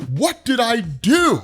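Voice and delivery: high, slow, panicked cry; panicking. Retold or imitated. Confused man, scared by his own power, panicking. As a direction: retell a frightened man's confused plea. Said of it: "That's why he's panicking".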